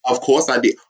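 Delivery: ordinary delivery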